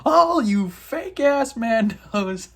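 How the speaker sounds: mock grumble at fake Mandos; annoyed mock voice. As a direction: sneer it like a disgusted rival. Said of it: "and he's like".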